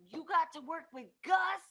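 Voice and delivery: high, loud amazed question; starstruck fan. Retold or imitated. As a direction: gush like an excited fan. Voicing amazed fans.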